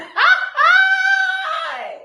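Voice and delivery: high shrieking laugh; loud laugh. Real, not acted. Laughing at the belly joke to viewers. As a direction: burst out laughing.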